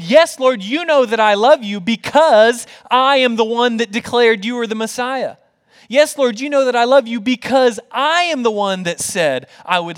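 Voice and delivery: high, loud, self-promoting declaration; boastful. Acted. Imagined Peter listing his credits to Jesus, boastful. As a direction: boast loudly, punching up your own credit. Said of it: "Peter could have boasted to Jesus".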